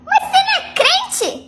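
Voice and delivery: high, slow, incredulous question; betrayed bride-to-be asking the cheater if he's really a believer; outraged disbelief. Retold or imitated. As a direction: stretch it out in shocked disbelief. Outraged at the fiancé.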